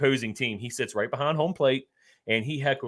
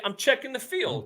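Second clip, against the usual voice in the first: joking excuse; 11 semitones higher, 3 dB quieter than usual. Pretend. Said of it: "you say".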